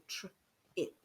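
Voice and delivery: tr, pause, then ip; split blend. Shown as a demonstration. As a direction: say tr, then ip, apart. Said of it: "more than one letter".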